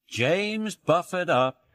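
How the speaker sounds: ordinary delivery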